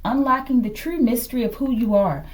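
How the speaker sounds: normal talking voice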